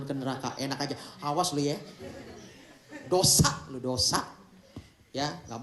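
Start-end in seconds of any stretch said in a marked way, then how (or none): 1.2-4.2 playful scolding. Pretend. Scolding the congregation jokingly.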